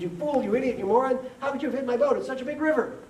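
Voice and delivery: high, loud farmer's tirade; hammed-up outraged yelling; angry screaming. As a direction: hurl the insults in acted fury. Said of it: "stands up and screams".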